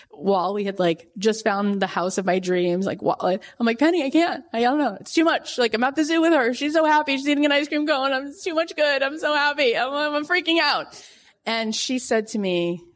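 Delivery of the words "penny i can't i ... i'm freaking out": high, breathless, tumbling panic of joy; overwhelmed. Retold or imitated. Author telling her hypnotherapist she is overwhelmed, frantic. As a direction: spill it out like it's all too much.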